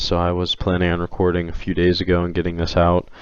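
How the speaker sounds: ordinary talk, nothing marked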